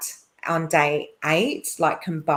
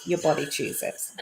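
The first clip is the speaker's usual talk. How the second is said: laughing; 4 dB quieter than usual. Real, not acted. Laughing at own point.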